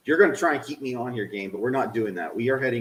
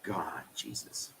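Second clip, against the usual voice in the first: a weary, unvoiced sigh of words; exasperated whisper; 8 semitones lower, 16 dB quieter, slower than usual. Real, not acted. Streamer fed up with the game, slow whisper.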